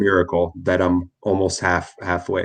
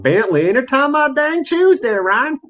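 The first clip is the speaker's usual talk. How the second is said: higher Appalachian hillbilly twang; hillbilly accent; 3 dB louder than usual. Pretend. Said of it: "my family's from the woods of Pennsylvania and West Virginia".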